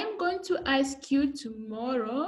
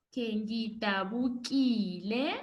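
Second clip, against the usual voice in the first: ordinary talk, nothing marked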